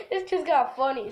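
ordinary talk

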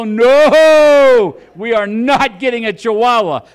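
long howled no, then emphatic; loud, high mock protest; outraged refusal. Husband flatly refuses the puppy.

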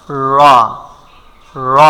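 র with tongue tip lifted, rounded full vowel; full Arabic r in র; curled-tongue ra. Shown as a demonstration. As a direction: lift the tongue for a heavy র. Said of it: "এভাবে উচ্চারণ করতে হবে".

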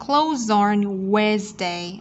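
slow, CLOTHES ON linked with a Z; linked sounds. Shown as a demonstration. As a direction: run "Clothes on" together, taking it slowly. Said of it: "đồng thời nối âm".

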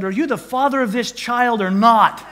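high, loud furious demand from a nurse; the nurse rounds on the dad, shouting high and loud; angry nurse yelling. Retold or imitated. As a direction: bark it like a raging Hulk. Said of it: "it's like a Hulk comes out of these little women".

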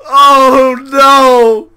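shrieking oh no in joyful disbelief, close to tears; ecstatic screaming. Real, not acted. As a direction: scream it out, overwhelmed with joy. Reacting to unboxing a rare knife.

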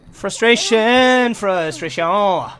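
very slow, stretched out; slow exaggerated frustration; drawn-out frustration. Real, not acted. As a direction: drag the word out, fed up. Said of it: "That's what I call frustration".

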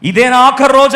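loud, high last plea; desperate cry. Acted. Believer praying as if dying, loud desperate cry. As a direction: cry it as your last prayer. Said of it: "ఏడ్చి ప్రార్థన చేసావా".

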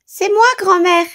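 put-on a wolf faking a little girl's voice; wolf in disguise. A narrator's reading. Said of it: "en changeant sa voix". (le loup qui déguise sa voix, aiguë)

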